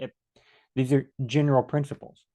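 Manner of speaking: ordinary talk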